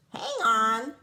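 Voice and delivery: the duck calling out to the rat; quacked call. Acted. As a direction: stop him with a quick loud cry. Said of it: "quacked the duck".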